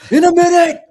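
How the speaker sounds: very loud, high-pitched shout back; mock parent's yell. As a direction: holler back at a pestering kid in jest. Joking yelled answer to pretend kid.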